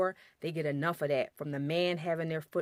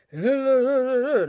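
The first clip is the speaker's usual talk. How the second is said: loud, high blustery laugh-shout; mock bass bellow; 7 semitones higher, 11 dB louder than usual. Wife mocking husband's loud blustery outburst. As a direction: ham up the husband losing his cool. Mimicking husband losing his temper.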